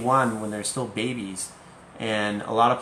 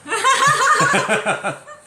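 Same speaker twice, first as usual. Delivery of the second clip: high laugh; 5 dB louder than usual. Real, not acted. Laughs at wrong answer.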